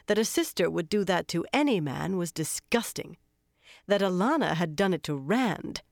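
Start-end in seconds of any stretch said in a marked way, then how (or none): none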